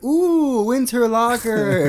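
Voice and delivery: high, squealing delight; excited. Retold or imitated. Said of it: "Exactly like that".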